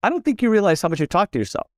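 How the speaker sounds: teasing boss pointing it out with a smile; a hint of a joking boss; imitating the boss. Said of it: "He goes".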